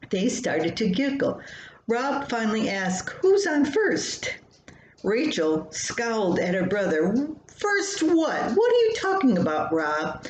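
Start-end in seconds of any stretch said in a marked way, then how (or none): 3.2-4.3 high, slow, joking voice; teasing brother. Acted. Brother teases with old joke. 7.5-10.3 put-on high, fast, miffed little-girl voice; a seven-year-old firing back at her brother; indignant child. Daughter snaps back at brother.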